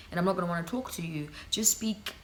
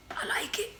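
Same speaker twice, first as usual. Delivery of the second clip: a high little whispered admission; whispered to the camera, admitting she likes it. Real, not acted. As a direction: sneak it in as a confession. Whispered aside.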